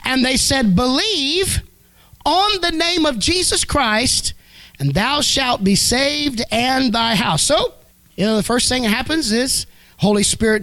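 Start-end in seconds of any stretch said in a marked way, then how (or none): 0.0-7.4 slow, high, ringing verse; scripture proclamation. Acted. Preacher reads Acts to the congregation, slow raised proclamation. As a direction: lift the promise slowly.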